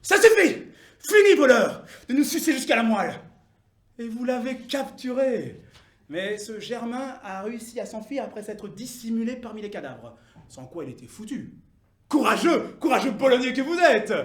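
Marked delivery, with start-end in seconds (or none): 0.0-3.1 high, very loud outburst; indignant yell, quickening to the end; defiant crowd protest. Acted. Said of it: "vous avez dit". 12.1-14.1 loud, high acclamation; booming oratorical flattery; rousing praise. Acted.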